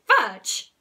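mother catching the culprit; a mother's sudden shout; shouted name. Acted. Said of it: "And then she shouted".

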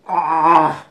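disgusted groan. Real, not acted. Reaction to terrible taste.

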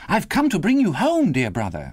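put-on high, delighted child's voice; gleeful little girl. A narrator's reading. Little sister comes to fetch her brother, gleeful. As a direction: burst out with joyful news. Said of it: "brimful of glee".

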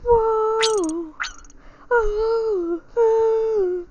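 high, startled whoa and oh; surprised gasp. Real, not acted. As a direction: caught off guard by a shock. Reacting with cry to game event.

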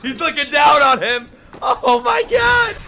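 high, loud, amazed yell; awed shouting. Real, not acted. Viewer seeing him look down on him, amazed high shout.

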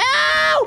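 high-pitched scream of alarm; startled scream. Real, not acted. Player sees the monster coming, startled scream. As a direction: scream as the chase begins.

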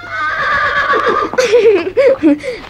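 a bright rising laugh of joy; delighted girlish laugh. Acted. Girl delighted as the pony bows to greet, laughing.

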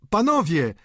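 loud, high appeal; calling out. A narrator's reading. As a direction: cry out to get the room's attention. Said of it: "zawołał Poiret".

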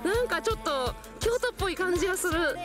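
ordinary talk, nothing marked

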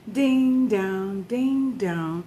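sung ding dong chime; bell chime imitation. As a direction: chime it like a doorbell. Imitating notification bell sound.

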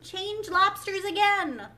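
high, loud, stretched storybook yell; top-of-voice yell. A narrator's reading. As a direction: yell the dance call out. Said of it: "yelled the Gryphon at the top of its voice".

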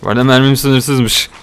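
normal talking voice

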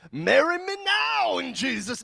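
high, booming hammed-up shout; mock bold proposal. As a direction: proclaim it like an over-the-top preacher. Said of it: "I was not that confident".

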